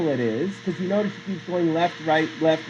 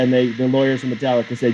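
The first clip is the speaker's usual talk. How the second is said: ordinary delivery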